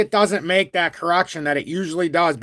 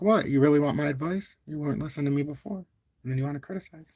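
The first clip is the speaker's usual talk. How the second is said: quiet retort; 6 dB quieter, faster than usual. Acted. Acting out own retort to critics.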